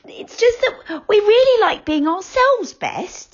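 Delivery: put-on high whispered little girl voice, slow; a small girl whispering shyly; little girl character, high whisper; Franny, little girl. A narrator's reading. Franny whispers her line.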